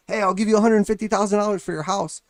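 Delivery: hollering an offer over the fence; neighbor yelling. Retold or imitated. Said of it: "your neighbor yells at you".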